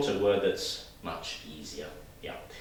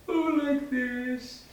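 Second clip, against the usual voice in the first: high, drawn-out silly voice; playful posture demo; 3 dB louder, much slower than usual. Shown as a demonstration. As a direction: stretch it out high and comical. Demonstrating a posture with voice.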